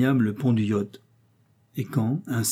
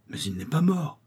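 breathless, gasped protest, a touch higher; gasping disbelief; 4 semitones higher, 4 dB quieter than usual. A narrator's reading. Narrator gasps at the doctor over the body, in breathless disbelief. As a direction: gasp it out in shock. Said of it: "haletai-je".

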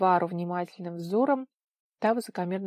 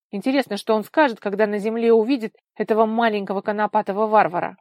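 mock her in a raised voice; scornful mockery; 3 semitones higher, 10 dB louder than usual. A narrator's reading. Vika mocks the girl with hostility. (язвительно, громко и насмешливо)

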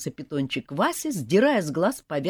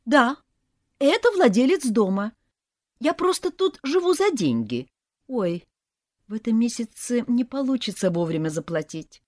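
higher, pleading put-on voice; pitiful tenant act; 8 semitones higher, 3 dB louder than usual. A narrator's reading. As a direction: play the poor tenant begging for time. Heroine pretending to be tenant.

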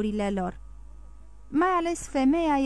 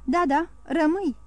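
higher-pitched, lightly acted tremulous voice; raised, mildly quavering plea; trembling voice; 6 semitones higher than usual. A narrator's reading. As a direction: urge it with a touch of tremor. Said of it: "glăsui și Carconta cu voce tremurătoare".

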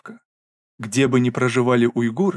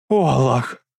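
sobbing cry; 4 dB louder, slower than usual. Acted. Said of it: "всхлипнула мама".

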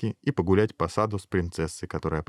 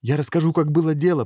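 raised, higher voice; trying to outshout; 7 semitones higher, 4 dB louder than usual. A narrator's reading. Hero trying to outshout the crowd, raised voice. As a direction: push the words up over a noisy crowd. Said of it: "попытался перекричать толпу герой".

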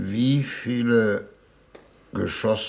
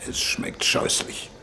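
whispered disgust; 4 dB quieter than usual. Acted. Envoy disgusted by the water.

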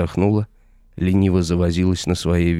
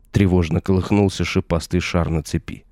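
ordinary delivery

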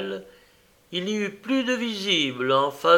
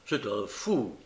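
quiet; 5 semitones lower, 7 dB quieter than usual. A narrator's reading. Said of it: "dit Alan".

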